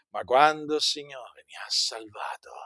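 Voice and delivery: voiceless, intimate whisper. Shown as a demonstration. Preacher telling the congregation of his salvation in a whisper. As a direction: breathe it like a secret. Whispers, then repeats aloud.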